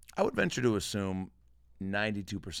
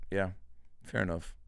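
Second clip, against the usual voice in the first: ordinary talk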